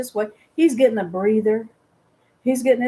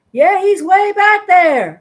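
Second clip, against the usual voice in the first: high, loud, slow call; 7 semitones higher, 9 dB louder, slower than usual. Retold or imitated. Mother tells her sons their father is far behind, loud slow call. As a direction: holler it back down the river.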